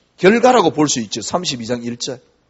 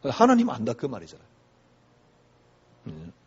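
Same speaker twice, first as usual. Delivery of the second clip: usual speaking voice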